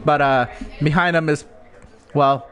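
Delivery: race commentary. Acted.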